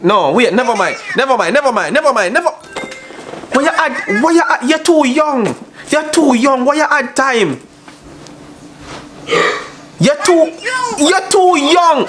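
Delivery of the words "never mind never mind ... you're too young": much higher, a hammed-up shocked voice; high mock outrage. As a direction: squeal it out like a scandalised auntie. Outraged at too-young girl.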